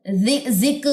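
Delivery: जिक with a hard bounced qaf; wrong pronunciation. Shown as a demonstration. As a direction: show the wrong जिक with qalqala. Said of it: "जिक नहीं पढ़ना". (जिक गलत, कलकला के साथ)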